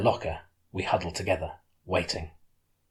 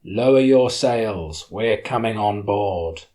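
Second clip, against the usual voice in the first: slow, loud order over a loudspeaker; 7 dB louder, much slower than usual. Acted. Police boat orders the yacht by loudspeaker, slow and blaring. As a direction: boom it out slowly like a police megaphone. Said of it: "A voice blared over a loudspeaker".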